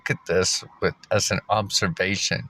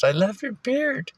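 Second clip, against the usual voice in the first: put-on much higher, slow girly gush; imitating the girl. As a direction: squeak it like a starry-eyed barista. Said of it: "She goes".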